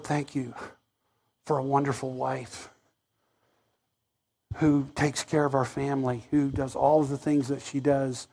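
very soft, low, halting thanks; hushed grateful prayer. Retold or imitated. Spared passenger thanking God for wife, hushed gratitude. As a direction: pray it privately, humbled, barely aloud.

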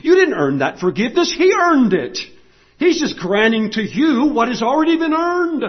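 impassioned pulpit delivery; full-force preaching. Real, not acted. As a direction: press the good news home with conviction.

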